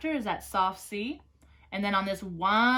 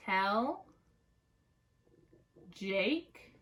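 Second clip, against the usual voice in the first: very slow, long pauses between softer words; word-by-word dictation; 6 dB quieter, much slower than usual. Shown as a demonstration. Teacher dictating word by word for writing. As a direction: give them time to write each word. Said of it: "go back and hear it again".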